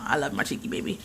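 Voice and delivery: soft whisper to her little one; tender whisper. Real, not acted. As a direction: whisper it lovingly into a cheek. Kissing her daughter goodnight.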